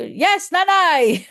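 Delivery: slow, loud, high shout; yelling back so she hears; shouted answer. Retold or imitated. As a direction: answer loudly to a hard-of-hearing elder. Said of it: "pabulyaw kasi hindi niya ako marinig".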